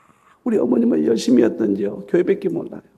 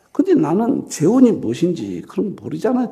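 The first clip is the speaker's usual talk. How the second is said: ordinary talk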